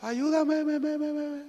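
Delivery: high cry with syllables repeating and fading; mountain echo. Retold or imitated. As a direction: imitate an echo dying away. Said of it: "Pues se oía el eco".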